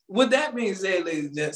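ordinary talk, nothing marked

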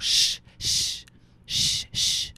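unvoiced shushing hiss. Inmates hushing each other early morning, shushing. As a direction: shush them like inmates at dawn. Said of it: "talking about".

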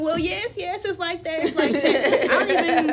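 yes it's like that, laughing; laughing insistence. Retold or imitated. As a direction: insist while cracking up. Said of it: "I was like".

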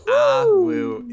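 high, loud wolf howl. Pretend. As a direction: let out a big howl. GM howls at natural twenty.

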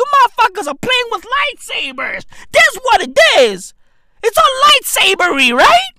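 high, loud, escalating exclaiming; excited comic rant. Real, not acted. Comic telling pill users they wield lightsabers, excited shouting. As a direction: build it to a yelled punchline.

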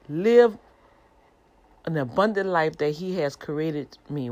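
ordinary talk

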